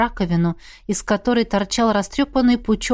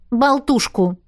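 higher, quick admission; blurted out; 4 semitones higher, slower than usual. A narrator's reading. Miranda admits what she planted, blurting it out. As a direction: blurt it before thinking. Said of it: "выпалила она".

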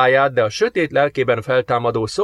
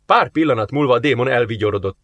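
ordinary delivery

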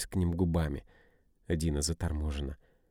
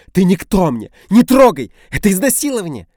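much higher, louder shrieking; hysterical screams; 13 semitones higher, 16 dB louder than usual. A narrator's reading. Dina screaming while fighting.

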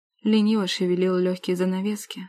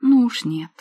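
plain reading voice

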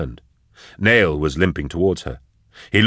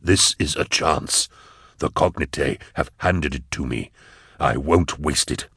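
grave, resolute Eisenhorn; 3 semitones lower, 3 dB quieter than usual. Acted. Voices the mind in the cage.

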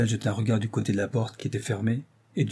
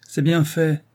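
higher-pitched, emphatic cry; vindictive exclamation; 6 semitones higher, 4 dB louder, slower than usual. A narrator's reading. Wife on hearing of Rose's death, exclaiming. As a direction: exclaim it with spite. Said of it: "s'écria madame Brun".

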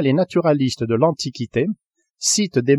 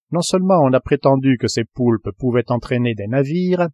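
ordinary delivery

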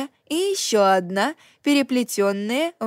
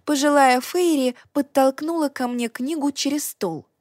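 plain reading voice

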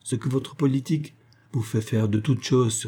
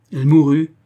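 louder, higher burst on mourut; sharp outburst; 5 semitones higher, 10 dB louder than usual. Acted. Dying old woman snapping at Raymond, louder. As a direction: blurt it out, rattled. Crone repeats phrase, agitated.

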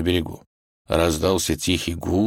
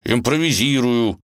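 strained, raised answer; through clenched teeth; 6 semitones higher, 3 dB louder than usual. Acted. Ivan explains his swinging to the wolf, teeth clenched. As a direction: force it out through gritted teeth. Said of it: "сквозь зубы пояснил Иван".

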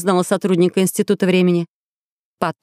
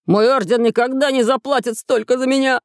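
higher-pitched cry of dismay; horrified exclamation; 4 semitones higher than usual. A narrator's reading. Templar hearing the price of his ransom, high horrified cry. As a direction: raise the pitch, protesting in horror. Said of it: "в ужасе воскликнул храмовник".

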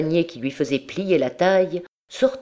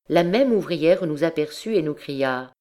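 ordinary delivery

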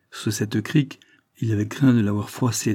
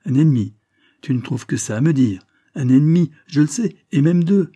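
louder, raised indignant protest; 5 semitones higher, 6 dB louder than usual. A narrator's reading. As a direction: throw the word back, loud and indignant. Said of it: "Delbon protesta".

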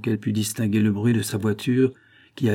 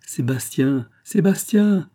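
rising to a high, loud call; 7 semitones higher, 4 dB louder, slower than usual. A narrator's reading. Mother calling her missing son, rising high and loud. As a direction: call Sébastien again, more desperate. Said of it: "elle appela".

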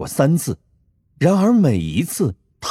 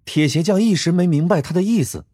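ordinary delivery, nothing marked

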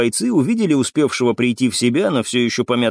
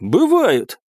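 higher-pitched, assertive interjection; bold contradiction; 7 semitones higher than usual. A narrator's reading. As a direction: step in and contradict boldly. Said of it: "произнёс я, решив выйти на сцену".

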